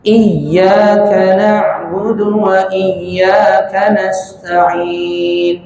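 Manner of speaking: slow chanted "iyyaka", double y pressed firmly; pressed tasydid. Shown as a demonstration. Teacher modelling the verse for repetition, pressed tasydid. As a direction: recite evenly, leaning on the yy of each "iyyaka".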